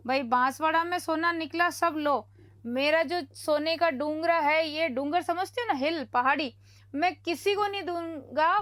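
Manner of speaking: ordinary delivery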